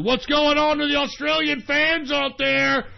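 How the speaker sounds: high-pitched call to the crowd; hyped shout-out. Real, not acted. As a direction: holler a greeting to distant fans. Hailing fans across the airwaves.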